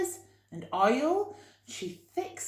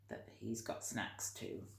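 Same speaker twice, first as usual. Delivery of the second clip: whispering; 8 semitones lower, 13 dB quieter than usual. Real, not acted. Whispered aside about dog.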